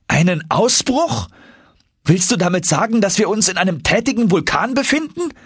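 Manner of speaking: high, disbelieving youthful voice; the nephew can't believe his ears; alarmed young Axel. Acted.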